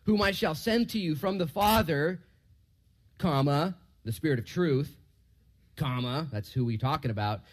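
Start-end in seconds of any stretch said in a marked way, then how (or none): 0.0-6.3 raised, slow Bible reading, even saying the commas; scripture reading. Acted. Preacher reading the verse aloud to his class: raised, measured reading. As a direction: read the verse out to the class, clearly and slowly.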